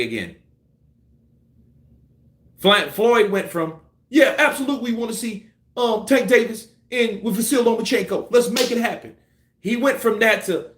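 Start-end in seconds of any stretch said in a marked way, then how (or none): 4.1-9.1 mock eager promoter. Voicing Floyd's changing positions.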